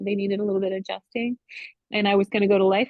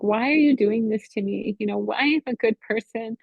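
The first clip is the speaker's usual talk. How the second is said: high, fast, anguished pleading; rising retold scream at God; 6 semitones higher than usual. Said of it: "screaming to the Lord".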